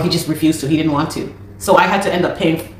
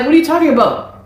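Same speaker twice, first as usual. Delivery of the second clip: shocked disbelief; 8 semitones higher than usual. Retold or imitated. Reacting to eviction news.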